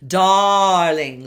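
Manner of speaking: "Darling" loud with wide open ah; open dark vowel. Shown as a demonstration. Coach models darling with open vowel. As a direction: give "Darling" lots of space. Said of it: "Lots of space".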